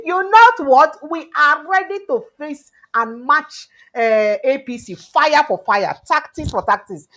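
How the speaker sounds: loud, punchy rally challenge; defiant boast. Retold or imitated. Newsreader voicing the coalition daring the ruling party, loud and defiant. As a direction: throw down the gauntlet at the ruling party. Acted quote of coalition threat.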